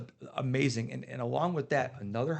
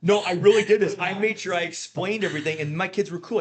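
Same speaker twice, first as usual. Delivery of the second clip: laughing insistence; 5 semitones higher, 7 dB louder than usual. Real, not acted. Laughing, emphatic defence.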